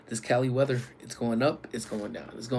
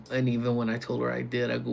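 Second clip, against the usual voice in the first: ordinary talk, nothing marked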